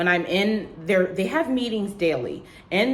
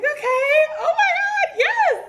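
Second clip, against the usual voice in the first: very high excited squeal; ecstatic acceptance; 5 dB louder than usual. Retold or imitated. Candidate accepts the job offer, ecstatic high squeal. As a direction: squeal a thrilled yes, hammed up.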